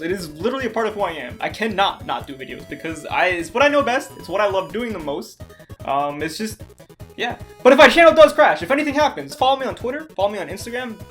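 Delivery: usual speaking voice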